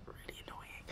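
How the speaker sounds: a muttered whispered aside; real whisper. Real, not acted. Complaining about her eye in a whisper. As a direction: drop to a voiceless aside.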